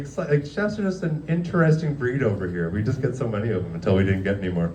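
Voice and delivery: ordinary delivery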